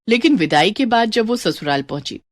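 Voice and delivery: usual speaking voice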